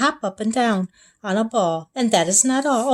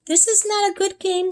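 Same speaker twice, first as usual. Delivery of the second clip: fish voice; 10 semitones higher than usual. A narrator's reading. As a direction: complain like a wet little fish. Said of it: "said our fish".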